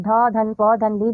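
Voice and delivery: very slow, each piece separate; deliberate teacher's spelling-out; sound by sound. Shown as a demonstration. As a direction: spell out ढपली in drawn-out pieces. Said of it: "अक्षरों, वर्णों को मिलाकर पढ़िए".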